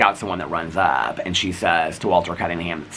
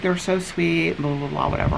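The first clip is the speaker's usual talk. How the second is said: high, mocking imitation of her gushing; lightly mocked sing-song gush; high airy mock gushing; gushing neighbor; 10 semitones higher than usual.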